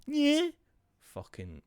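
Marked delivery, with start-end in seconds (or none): 0.1-0.5 very high, loud squeaky mimicry; mock his annoying noise in a piercing high voice; imitating a verbal tic. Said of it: "he makes a noise".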